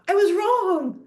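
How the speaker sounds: cheerful I was wrong; happy admission. Retold or imitated. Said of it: "And I'll go".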